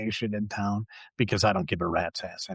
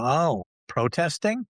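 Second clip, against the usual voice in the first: slow, higher, knowing clerk's question; curious store clerk; 7 semitones higher than usual. Retold or imitated. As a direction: draw out the question like a nosy clerk. Clerk eyeing the customer's purchase.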